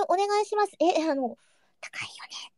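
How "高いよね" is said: tiny unvoiced murmur of a whisper; hushed whisper. Shown as a demonstration. Streamer answers praise for whispering, hushed whisper. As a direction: whisper it for the listeners. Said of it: "高いよねのささやきで".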